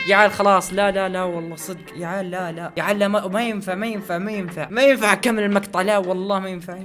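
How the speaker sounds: ordinary delivery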